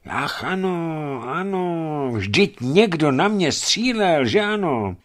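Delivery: high, slow, bewildered voice of a man just coming to; a dazed geologist slowly remembering he was shot; dazed wounded man. Acted. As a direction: drag the words out as if still half-stunned. Dazed wounded man recalling shooting.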